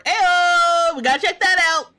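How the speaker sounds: high, loud, thrilled call; excited ayo. Real, not acted. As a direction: jump on the news with glee. Reacting to free recipes.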